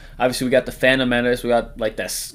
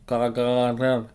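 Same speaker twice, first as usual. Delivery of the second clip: drawn-out silly voice; much slower than usual. Pretend. Name drawn out slowly, odd voice.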